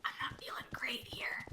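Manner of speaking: hushed unvoiced whisper. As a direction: whisper it nervously. Whispering in eerie place.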